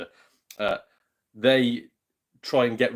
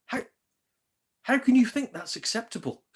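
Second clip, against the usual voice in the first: outraged buyer; 12 semitones higher, 3 dB louder than usual. Retold or imitated. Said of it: "I was like".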